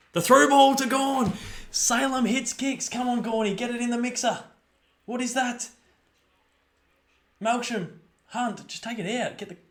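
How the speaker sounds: high, loud urging of the players; excited urging. Real, not acted. Fan urging the team to get it in the mixer, excited and high. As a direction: lean in and will the ball forward.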